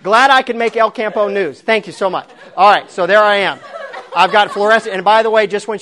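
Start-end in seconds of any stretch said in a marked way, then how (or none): 0.0-2.3 sarcastic thanks. Retold or imitated. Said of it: "I'm like".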